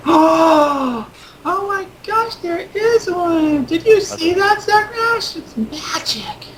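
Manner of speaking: put-on very high, squealing child in slow wonder; awestruck little girl. Little girl telling Zug Nash about magic, awestruck squeal. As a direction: gasp it out sky-high, stretching the words.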